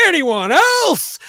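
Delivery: slow, high, loud challenge; calling out to the crowd. Retold or imitated. Comic on the tables daring the crowd, slow and loud. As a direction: stretch it out and throw it to the room.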